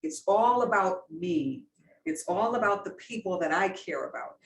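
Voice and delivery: usual speaking voice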